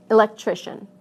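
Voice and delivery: elec-TRI-cian, weight on TRI; stress before the suffix. Shown as a demonstration. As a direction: punch TRI in electrician. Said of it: "stress moves right to the syllable".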